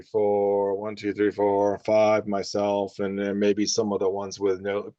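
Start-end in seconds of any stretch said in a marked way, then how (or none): none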